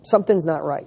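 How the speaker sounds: ordinary delivery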